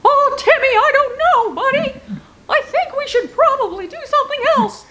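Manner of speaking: very high cartoon voice; very high-pitched, hesitant sidekick; cartoon companion. Cartoon companion answering Timmy Turner.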